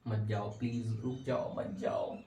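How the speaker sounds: low, hushed mock begging; mock pleading. Fuse begs the current not to go, mock pleading. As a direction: plead softly, fading almost to a whisper.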